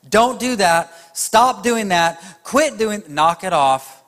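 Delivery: loud, high parental scolding. Retold or imitated. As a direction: snap at the kids like a fed-up parent. Nagging parent voiced.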